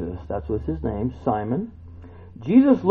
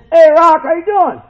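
hearty greeting; 11 dB louder than usual. Pretend. Acting out a greeting to Peter.

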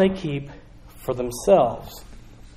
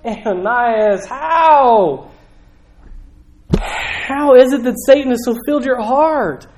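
higher, louder anguished plea; pleading; 6 semitones higher, 4 dB louder than usual. Retold or imitated. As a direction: beg him to explain, heartbroken. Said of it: "I hear him pleading".

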